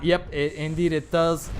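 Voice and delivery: usual speaking voice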